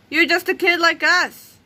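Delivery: put-on defiant kid. A narrator's reading. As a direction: talk down to him slowly.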